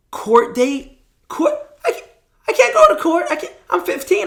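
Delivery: high, loud, laughing disbelief; mock panic. Fifteen-year-old hearing he must go to court, laughing comic horror.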